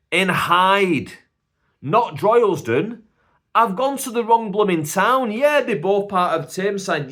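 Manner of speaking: high, loud, incredulous rant; loud, panicked exasperation; exasperated outburst. Retold or imitated. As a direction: blurt it out in loud high dismay. Acted outburst at wrong town.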